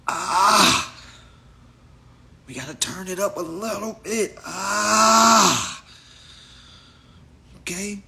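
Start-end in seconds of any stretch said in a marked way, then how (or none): 0.1-0.9 sudden loud, high open yell; short high shout of "ah". Shown as a demonstration. Test yell to turn it up. 4.4-5.8 long, high, full-volume "ah"; sustained high shout; loud "ah" yell. Shown as a demonstration. Yells after turning it up.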